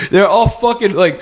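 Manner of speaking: ordinary talk, nothing marked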